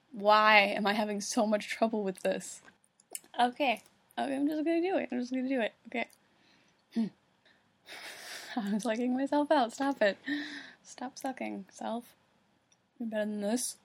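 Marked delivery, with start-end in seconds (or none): none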